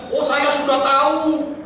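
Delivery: slow smug oh i already know; lightly mocking dismissive reply; mock know-it-all. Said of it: "jangan bilang".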